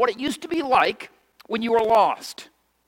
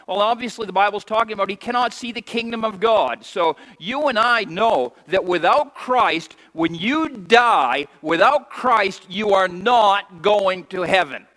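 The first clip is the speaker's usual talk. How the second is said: ordinary talk, nothing marked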